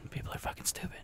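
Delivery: whispered mock grumble; a whispered, grumbling take on his own sour mood. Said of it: "I'm gonna be over here like".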